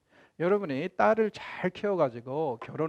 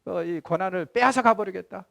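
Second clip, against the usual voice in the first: louder, higher stern rebuke; acted angry God threatening his prophet; God snapping back; 4 semitones higher, 6 dB louder than usual. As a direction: scold like an exasperated father silencing a child. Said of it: "대받아치십니다".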